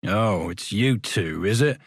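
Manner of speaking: a mild, grudging growl. Acted. Porter Ferriby growling at the two boys at the door. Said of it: "he growled".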